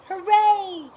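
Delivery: loud, high burst of joy; joyful cheer. Acted. Mister Goat cheers after writing his song, loud and high. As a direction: cheer like you just had a great idea.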